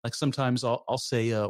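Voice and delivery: ordinary talk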